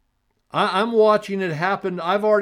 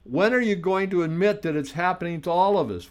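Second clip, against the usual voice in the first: usual speaking voice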